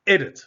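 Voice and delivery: EDIT with loud, high ED; first-syllable stress. Shown as a demonstration. Teacher shows the unstressed ending, EDIT loud on ED. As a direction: punch the start of EDIT, ED-it. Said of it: "second syllable is not stressed".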